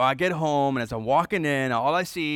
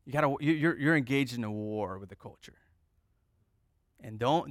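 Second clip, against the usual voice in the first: normal talking voice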